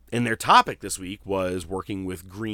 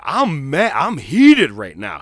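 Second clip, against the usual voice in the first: higher, louder, slow hammed-up fury; mock angry Mark Henry; 5 semitones higher, 11 dB louder, slower than usual. Mark Henry fuming about Logan Paul's podcast, loud mock anger. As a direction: play him fuming and worked up. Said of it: "Mark Henry was lit".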